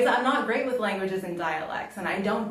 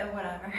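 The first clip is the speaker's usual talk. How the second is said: whatever trailing off on a soft sigh, quick; 7 dB quieter, faster than usual. Real, not acted. To her viewers, a weary sighed whatever. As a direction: sigh out the word whatever, much quieter. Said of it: "enjoying whatever".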